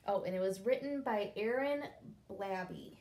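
ordinary delivery

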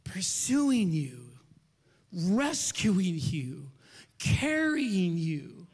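very slow, each word stretched; drawn-out preaching. Real, not acted. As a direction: linger on every phrase with long pauses. Preacher dwelling on each phrase.

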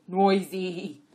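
loud donkey retort; insistent repeat. A narrator's reading. Jack bickering back at his partner, loudly stubborn. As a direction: throw the word back loudly. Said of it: "Abbott repeated".

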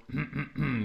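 demonstrated throat-clearing. Shown as a demonstration. Voice coach showing a student the larynx move, throat-clearing. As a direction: clear the throat in short bursts. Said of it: "that kind of clearing of your throat".

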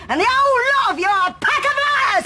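high-pitched angry outburst; furious accusation. Acted. Mother attacking the rival family, furious accusation. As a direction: build to a shouted condemnation.

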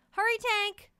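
high, loud hurried call from dad; urging dad. Acted. Dad hurrying his son along, high loud call. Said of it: "parent voiced urging son".

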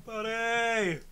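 mock reply. Playing kids answering the counselor, mock call. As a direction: answer in a long, lighter call.

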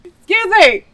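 suddenly loud after a bug; blurted out, startled. Real, not acted. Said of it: "I think I just ate a bug".

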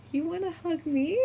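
high, astonished little question; touched disbelief. Retold or imitated. Fan answering actress's hug offer, amazed. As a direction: ask it high, as if unable to believe it.

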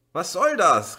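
high, loud outraged protest; indignant. A narrator's reading. Uli protesting indignantly at his sister. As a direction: protest like a fed-up child. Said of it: "rief er empört".

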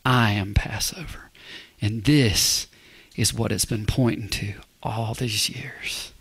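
soft, low whisper. Real, not acted. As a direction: lean in and whisper it. Hushed reveal of meaning.

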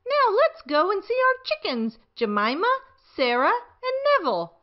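high sing-song granny; granny leading the kids to the chickens; Granny Pig's voice. A narrator's reading. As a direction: call the children along like a cheerful granny. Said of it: "says Granny Pig".

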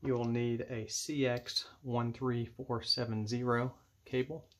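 ordinary delivery

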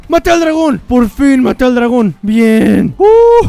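loud, high victory cheer; triumphant shout. Real, not acted. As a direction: celebrate the kill at full voice. Finally kills the dragon.

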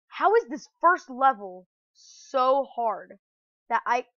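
loud, slow exasperation; frustrated gamer complaint; exasperated outburst. Real, not acted. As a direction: stretch it out in loud disbelief. Exasperated at failing the first level.

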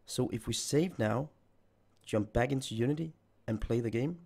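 ordinary delivery

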